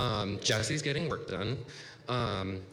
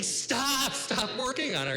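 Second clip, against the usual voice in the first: high-pitched, louder mock panic cry; panicked plea; 4 dB louder than usual. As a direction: burst in urgently to halt everyone, hammed-up. Panicked interruption in the emergency room.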